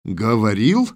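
startled, amused rising question; can't believe what he heard; incredulous echo with a rise; surprised question. A narrator's reading. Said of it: "Ульрих засмеялся от неожиданности".

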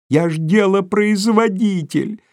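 high, slow squeal in terror. A narrator's reading. Terrified clerk squeals after the fleeing boss. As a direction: shriek it out in panic. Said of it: "взвизгнул Коротков".

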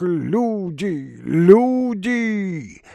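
high, drawn-out cry; panicked call for help. Acted. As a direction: call out for servants in alarm. Старик в панике зовёт людей. (протяжный крик о помощи)

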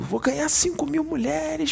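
high, drawn-out delighted voice of a greedy man; mock crow of delight at the prize; imitating a man celebrating his windfall; gleeful celebrating. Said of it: "Ficou comemorando".

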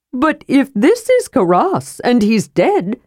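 high-pitched, puzzled kender; Tass. A narrator's reading. Kender questions who the dead hero is, high and puzzled.

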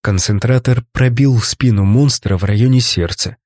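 ordinary delivery

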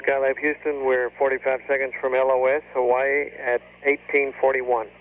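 crackly radio transmission; over a space radio link; space radio beeps. Real, not acted. Houston calls Skylab over radio.

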